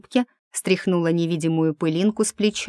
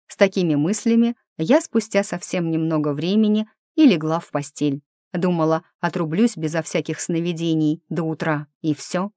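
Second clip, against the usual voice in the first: ordinary delivery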